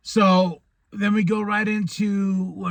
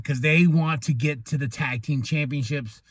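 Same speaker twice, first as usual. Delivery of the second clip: ordinary delivery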